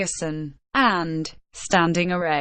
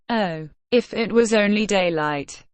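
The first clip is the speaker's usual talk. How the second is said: ordinary delivery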